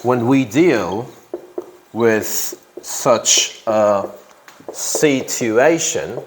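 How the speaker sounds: word by word with long pauses; slow dictation. Real, not acted. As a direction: dictate slowly, stretching out "situation". Dictates the sentence while writing.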